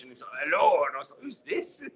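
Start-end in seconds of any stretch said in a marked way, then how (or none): 0.4-0.8 broad Scottish hello. Retold or imitated. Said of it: "he's very broad Scottish". 1.2-1.8 much higher, bewildered squeak; puzzled who's this. Retold or imitated. Confused reply to mystery caller.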